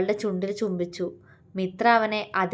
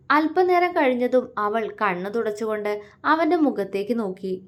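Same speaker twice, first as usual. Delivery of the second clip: plain reading voice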